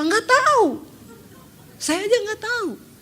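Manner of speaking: high, thrilled disbelief; he can't believe the money came; excited husband. Retold or imitated. Husband amazed on phone. (suami girang dan heran dengan suara tinggi)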